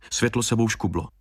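ordinary delivery, nothing marked

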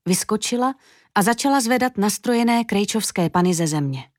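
ordinary delivery, nothing marked

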